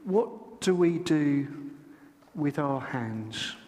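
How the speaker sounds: slow, with long pauses between the words; slow, weighty question. Real, not acted. Preacher asking the congregation, slow and weighty. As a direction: let each word land and hang.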